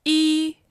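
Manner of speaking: E held high and level; first tone. Shown as a demonstration. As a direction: keep E flat and high. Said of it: "first tone".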